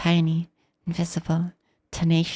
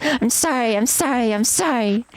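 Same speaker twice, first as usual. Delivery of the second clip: higher, mild pleading sorry on repeat; groveling; 5 semitones higher than usual. Acted. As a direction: beg forgiveness with each sorry. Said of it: "Grovel for forgiveness".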